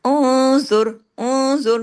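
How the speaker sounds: उंजुर loud and slow, नून hidden in the nose; loud drawn recitation; nasal ikhfa, loud. Shown as a demonstration. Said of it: "इख्फा हकीकी गुन्ना कामिला".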